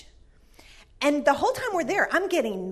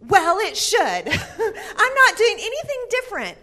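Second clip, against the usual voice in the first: laughing; 8 semitones higher, 6 dB louder than usual. Real, not acted. Retelling her stubborn answer, laughing.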